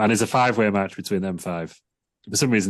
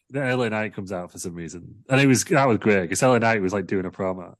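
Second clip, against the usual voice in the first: ordinary talk, nothing marked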